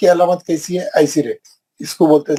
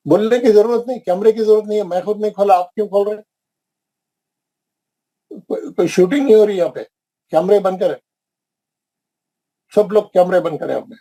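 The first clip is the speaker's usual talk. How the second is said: raised pitch, exasperated telling-off; irritated scolding; 5 semitones higher, faster than usual. Real, not acted. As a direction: scold the class for switching cameras on. Teacher scolding students about cameras. (کیمرے کھولنے پر طلبہ کو جھنجھلا کر ڈانٹنا)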